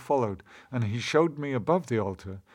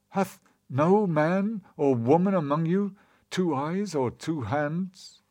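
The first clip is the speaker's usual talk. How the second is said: higher pleading cry, slowing at the end; anguished cry; 6 semitones higher, slower than usual. A narrator's reading. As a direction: cry out to the crowd. Narrator "cried" to people.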